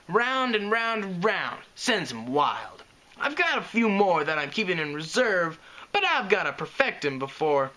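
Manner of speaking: put-on boastful young filly. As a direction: brag like a sporty young pony. Scootaloo boasting to her friends.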